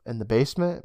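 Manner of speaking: plain reading voice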